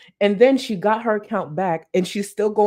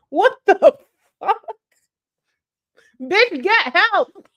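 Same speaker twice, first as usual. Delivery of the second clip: shocked outburst; 12 semitones higher, 7 dB louder, slower than usual. Pretend. Mock outraged reaction.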